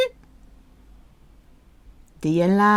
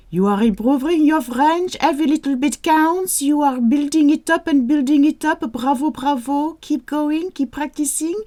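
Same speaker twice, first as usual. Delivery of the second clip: higher, upbeat praise; cheerful encouragement; 7 semitones higher than usual. Real, not acted. Teacher praising learners for their French progress.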